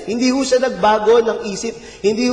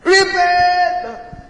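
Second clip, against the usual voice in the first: mock shout again; 9 semitones higher than usual. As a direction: call it out once more to an empty desert. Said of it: "Isa pa".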